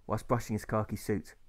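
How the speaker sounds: plain reading voice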